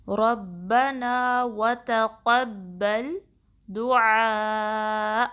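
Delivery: slow, drawn-out tajweed chant; measured Quranic recitation with long held vowels. Shown as a demonstration. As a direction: recite it slowly and melodically, stretching every vowel. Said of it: "آئین کو آپ نے چار count stretch کرنا ہے".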